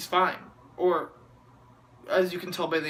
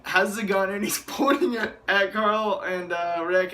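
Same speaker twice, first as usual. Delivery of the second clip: ordinary talk, nothing marked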